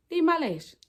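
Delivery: sassy, high and loud declaration; defiant. Real, not acted. To critics who called her lashes ugly, a sassy defiant retort. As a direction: claim them proudly, with attitude.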